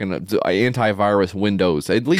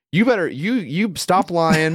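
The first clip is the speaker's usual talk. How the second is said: higher, scornful accusation, stop lying drawn out slowly; mock aggressive attendant; 8 semitones higher, slower than usual. Attendant accusing the cab driver, higher and scornful. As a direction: retell him jeering and pissed at once. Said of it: "so aggressive and, like, laughing at this guy, but also is pissed".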